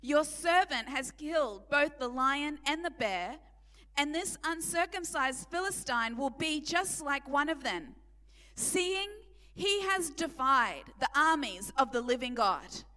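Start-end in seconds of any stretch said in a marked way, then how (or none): none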